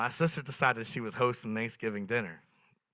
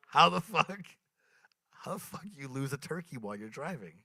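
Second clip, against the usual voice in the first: disbelieving rant to the crowd; incredulous; 5 semitones higher than usual. Acted. Comic baffled at losing a turkey, incredulous.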